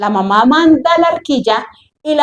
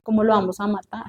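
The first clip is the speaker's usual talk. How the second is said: lower, much softer, hushed pity; pitying princess; 4 semitones lower, 7 dB quieter than usual. Acted. Princess pitying the baby, hushed reluctance. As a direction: recoil softly from the idea. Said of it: "ella lo vio y dijo".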